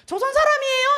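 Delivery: put-on high, loud call in a northern accent; 북한 사투리로 외치는 질문; North Korean accent. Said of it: "사투리 비슷한 이북 말".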